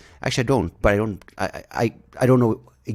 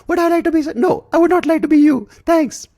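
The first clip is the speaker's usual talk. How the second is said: much higher, louder mimicking the pitchman's squeak; mocking ad voice back; 8 dB louder than usual. Answering the ad guy in his own high mock voice. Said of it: "And you're like".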